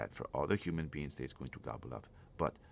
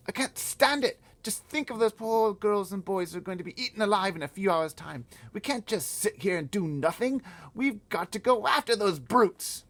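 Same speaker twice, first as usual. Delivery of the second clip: put-on high, loud, desperate girl's cry; 12 dB louder than usual. Sophie crying out to the giant, high and loud. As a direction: stand up and cry out in protest. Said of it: "she stood up and cried out".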